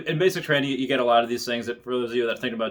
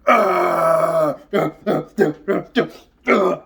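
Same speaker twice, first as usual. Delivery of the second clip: loud, higher furious grunts; angry grunts; 6 semitones higher, 7 dB louder, much slower than usual. Retold or imitated. Enraged drill sergeant breaks guidon pole, loud grunts. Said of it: "he's getting pissed off".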